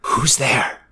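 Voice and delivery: shaky whispered question; quavering whisper. Acted. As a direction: whisper it, voice trembling. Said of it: "In a quavering voice, whisper out".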